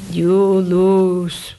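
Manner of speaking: low, loud terse verdict with weight on lose; a hint of stern Coolidge; Coolidge quote. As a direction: drop the voice and land hard on lose like a curt president. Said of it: "what President Coolidge said".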